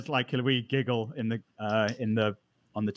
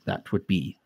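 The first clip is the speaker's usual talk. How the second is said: softer hushed price; conspiratorial whisper; 5 semitones lower, 4 dB quieter than usual. Acted. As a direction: breathe the price like a secret. NPC names a huge price.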